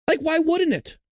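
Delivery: high, exasperated cry; exasperated outburst. Real, not acted. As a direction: throw the question up in disbelief. Peak of his angry rant.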